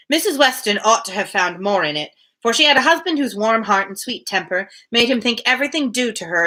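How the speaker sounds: steady read-aloud of a classic novel; measured literary narration; story narration. Acted. Reads novel aloud between chat.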